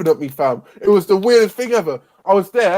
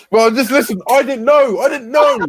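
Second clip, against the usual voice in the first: raised, louder self-defence; protesting; 7 semitones higher, 3 dB louder than usual. Real, not acted. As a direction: plead your innocence, pitch high. Defending himself as friends laugh.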